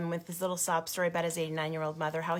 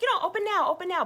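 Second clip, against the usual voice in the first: excited urging; 9 semitones higher, faster than usual. Retold or imitated. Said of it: "I was like".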